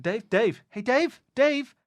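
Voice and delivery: calling out. Acted. Verge calling to slumped Dave, increasingly concerned. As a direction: try to rouse a friend, voice climbing. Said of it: "Verge calls out a few times".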